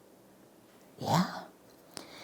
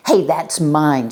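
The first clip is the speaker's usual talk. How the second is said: slow, louder protest; shouted; 7 dB louder, slower than usual. Acted. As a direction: call it out from the floor. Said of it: "shouts Schubert from the floor".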